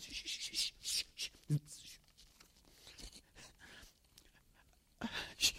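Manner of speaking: long soft unvoiced hush; hushing whisper. Jesus soothing the woman, whispered shh. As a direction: shush her tenderly.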